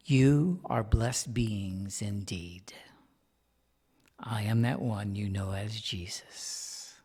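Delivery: very slow, whispered reading; slow hushed sacred voice; reverent whisper. Real, not acted. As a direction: read it slowly as a sacred greeting. Reading Jesus' greeting reverently.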